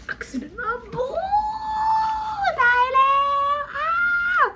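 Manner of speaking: high squealing excitement; shrieking fan excitement; super excited. Real, not acted. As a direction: squeal wildly at the first glimpse. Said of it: "Oh my god, I'm super excited".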